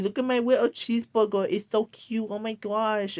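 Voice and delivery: mocking an adult woman's cutesy baby talk. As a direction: gush over it like a toddler. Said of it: "She was like".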